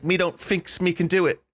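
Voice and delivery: exaggerated British Cockney imitation; mock Cockney. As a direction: make fun of a Cockney accent. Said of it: "the way British people talk ... like, Cockney".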